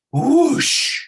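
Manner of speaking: long, loud, high-pitched whoosh; imitated whoosh. Shown as a demonstration. Trader mimicking the market swinging back, drawn-out whoosh. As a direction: stretch out a big rushing swoosh.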